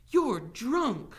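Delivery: high, loud accusation; indignant yell. A narrator's reading. Teacher breaking free from sheriff, yelling. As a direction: yell it at him in disgust. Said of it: "she yelled".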